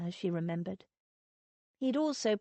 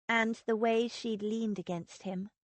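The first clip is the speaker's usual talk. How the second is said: plain reading voice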